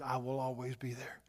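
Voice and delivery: hushed, tender whispered promise. Acted. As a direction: whisper it like a comforting vow. Jesus voiced reassuring Mary.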